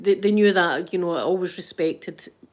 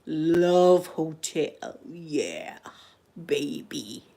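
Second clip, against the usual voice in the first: slow, soft seductive whisper; whispered bedroom voice, love hotel tease; 4 semitones lower, 4 dB quieter, much slower than usual. Pretend. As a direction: breathe it like a lover.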